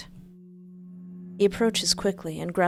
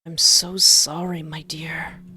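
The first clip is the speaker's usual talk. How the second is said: gentle apology; 6 dB louder, slower than usual. Acted. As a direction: whisper it gently to calm her. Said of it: "trying to sound as gentle as possible".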